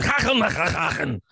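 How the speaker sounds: harsh throaty fake-German noises; mock German gibberish. Shown as a demonstration. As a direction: spit out guttural nonsense German. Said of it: "I mean, German".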